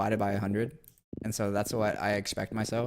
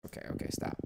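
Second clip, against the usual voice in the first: "Okay, okay, stop" whispered to himself, much lower and softer; 8 dB quieter, faster than usual. Real, not acted. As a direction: hush yourself under your breath.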